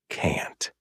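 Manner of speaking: bitter breathy whisper. A narrator's reading. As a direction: let the word die in a whisper. Bitter word list ends.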